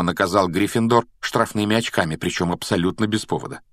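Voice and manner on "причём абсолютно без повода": ordinary delivery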